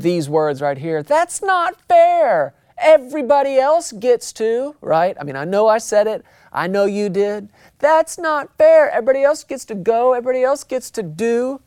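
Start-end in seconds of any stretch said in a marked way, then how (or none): 1.0-4.8 put-on high, slow childish whine; whiny kid. Pastor whining like a kid at his parents. 7.8-11.6 put-on whiny kid. Pastor whining like a kid at his parents.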